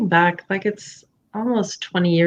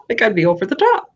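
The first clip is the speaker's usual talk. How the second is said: laughing fast; faster than usual. Retold or imitated. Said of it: "I'd be like".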